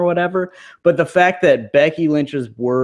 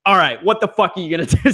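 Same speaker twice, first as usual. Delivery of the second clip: annoyed; 7 semitones higher, much faster than usual. Retold or imitated. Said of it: "like, fucking annoyed".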